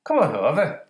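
slightly high, shaky attempt at Irish; Irish accent. Testing it on co-host, amateur Irish accent. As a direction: try out a tentative Irish lilt. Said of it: "is that Irish?".

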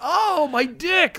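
high, slow hammed-up groan; mock pained moan. Villain mocked groaning slowly in pain. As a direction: drag out a mock cry of pain. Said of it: "They're like".

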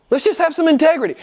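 loud, high, rapid appeal; forceful exhortation. Real, not acted. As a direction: fire it out at full preaching force. Appeal repeated with full force.

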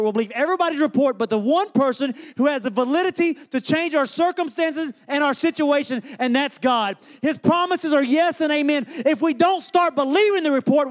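high, driving sermon delivery; full-force preaching. Real, not acted. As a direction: build without letup, pressing each phrase on the congregation.

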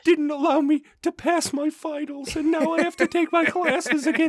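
put-on high, whining teen complaint; mock whiny teen. Mocking a crying student on TikTok, high whine.

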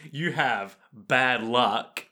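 "bad luck" said slowly in audible inverted commas; slow down on "you have bad luck" as if quoting; air quotes. Shown as a demonstration. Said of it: "communicating the inverted commas that I'm doing".

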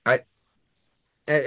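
ordinary talk, nothing marked

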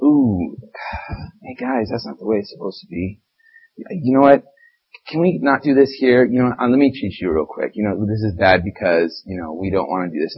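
soft, timid pleading voice; meek mock Jesus. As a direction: play him as a limp pushover. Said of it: "Do you think Jesus was really like".

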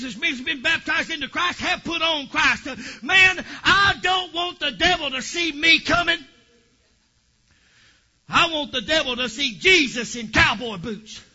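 loud, high shouting sermon; preaching. Acted. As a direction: drive it home to the church. Preaching about the devil.

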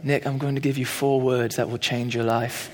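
a gentle mentor's hint of a British accent; quoting a British pastor in a soft British accent; Dave's British accent. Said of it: "in his British accent".

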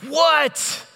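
high, loud impatient snap. Retold or imitated. Dad stomping into the kitchen to his family, very impatient. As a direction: fire it out, fed up at being interrupted. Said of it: "in a very impatient tone".

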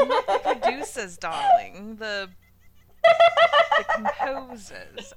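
high, loud mock-posh British with a New York twang; exaggerated British accent. Correcting the word for music composers, hammed-up British accent. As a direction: overdo the English accent, laughing through it. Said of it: "your English accent has a twang".

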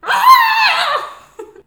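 loud, high goat scream. Acted. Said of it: "in the screaming goat voice".

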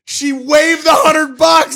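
high, loud, drawn-out shout of amazement; triumphant shout. Real, not acted. Telling friend the fee was waived, triumphant shout. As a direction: shout the punchline slowly, thrilled. Triumphant climax of toll story.

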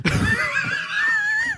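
high squealing laugh; a sudden shrieking laugh; burst of laughter. Real, not acted. Laughing at partner's stare.

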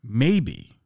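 usual speaking voice

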